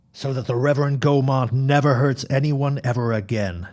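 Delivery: ordinary delivery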